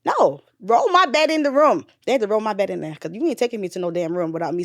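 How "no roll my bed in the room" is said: rising to a loud, high insistence; insistent refusal. Retold or imitated. New mother refusing the nurse, insistent raised voice. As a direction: refuse to be sent away, voice climbing.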